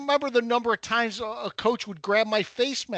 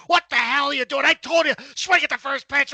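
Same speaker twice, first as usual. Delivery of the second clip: higher, louder mock chewing-out; coach chewing out; 7 semitones higher, 5 dB louder than usual. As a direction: rip into the batter like an angry coach. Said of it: "He's chewing me out like".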